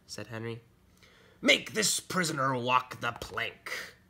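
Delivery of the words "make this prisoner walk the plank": slow, commanding pirate voice; pirate captain. Acted. Pirate captain ordering his son.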